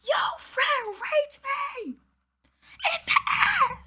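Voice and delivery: high, hammed-up mock shriek with a laugh; squealed mock quote, half laughing; mocking her message. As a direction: parody her dramatic accusation. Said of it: "you talking about some".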